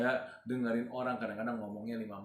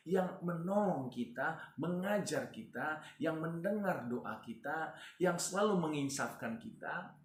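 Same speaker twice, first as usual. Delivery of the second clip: higher, slow, deliberate listing; preacher stressing each phrase; slow emphatic preaching; 4 semitones higher, slower than usual. Real, not acted. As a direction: lay out each work of the Spirit slowly. Preacher listing Holy Spirit's works.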